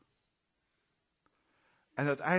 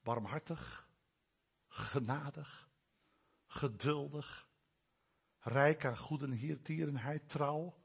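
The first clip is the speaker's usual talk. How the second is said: savoring words; 3 semitones lower, 7 dB quieter than usual. Shown as a demonstration. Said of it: "proef hier de woorden van goedheid".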